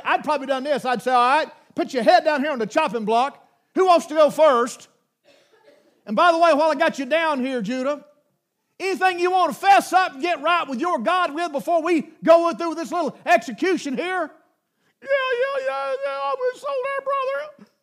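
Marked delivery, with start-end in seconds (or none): 1.1-14.3 louder, higher, hammed-up menace; mock executioner. Preacher pretending to threaten the brothers, hammed-up loud. 15.0-17.7 very high, mock-terrified voice; squeaky panicked confession. Brothers blurting a confession to Joseph, squeaky and panicked.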